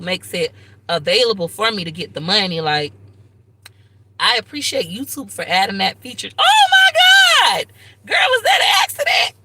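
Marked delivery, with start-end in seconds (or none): 6.4-9.3 high screaming disbelief; piercing surprised yell; shocked scream. Real, not acted. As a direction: shriek in real shock at the gift. Shocked by a hundred dollar superchat.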